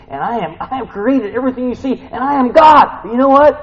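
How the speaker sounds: high, loud declaration in God's voice; full-force preaching. Acted. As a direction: build to a shouted climax.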